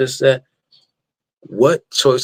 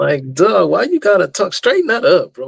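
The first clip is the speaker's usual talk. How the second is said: mock scold; 7 semitones higher than usual.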